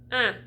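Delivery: un as a nasal eh; nasal French un. Shown as a demonstration. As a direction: put eh in your nose for un. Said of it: "that eh sound in your nose".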